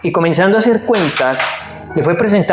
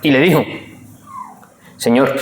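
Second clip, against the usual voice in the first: scripture reading; 4 semitones lower than usual. Acted. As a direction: read the verses with reverence. Reads the scripture passage aloud. (lectura bíblica solemne)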